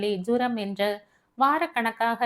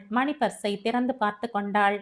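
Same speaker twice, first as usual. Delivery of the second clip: usual speaking voice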